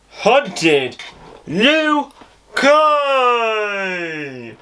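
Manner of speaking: big rising announcer call of the show name; excited title call. Acted. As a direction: proclaim the title like a TV promo.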